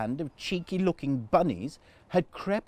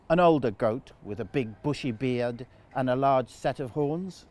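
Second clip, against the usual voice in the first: usual speaking voice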